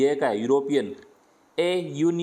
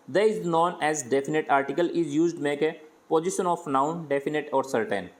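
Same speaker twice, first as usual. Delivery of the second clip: slow dictation; much slower than usual. Shown as a demonstration. Dictates definition slowly.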